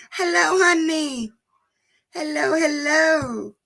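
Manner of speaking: high, slow sing-song greeting to a dog; pet talk. Real, not acted. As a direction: coo hello to the dog, drawn out. Greeting her dog again.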